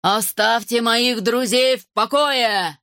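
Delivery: slow booming dragon command; big forceful demand in a put-on voice; thunderous voice. A narrator's reading. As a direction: thunder it slowly, every word drawn out. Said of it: "громовой голос за спиной".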